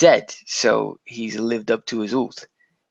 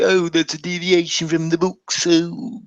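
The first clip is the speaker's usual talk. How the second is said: higher-pitched mock snobbish complaint; pretentious book snob; 4 semitones higher than usual. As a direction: put on a pretentious know-it-all. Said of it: "you're the pretentious ass that was going around".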